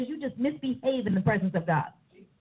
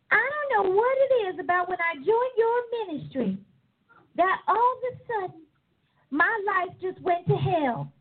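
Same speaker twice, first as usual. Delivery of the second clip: higher, complaining mock quote; complaining church member; 6 semitones higher than usual. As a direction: mimic the whiny member blaming the church. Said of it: "my least favorite line".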